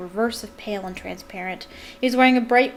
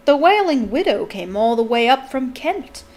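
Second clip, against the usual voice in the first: louder, boastful swoops; proud; 4 semitones higher, 8 dB louder than usual. A narrator's reading. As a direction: brag about a distinguished guest. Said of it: "said Nearly Headless Nick proudly".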